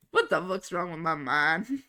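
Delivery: ordinary delivery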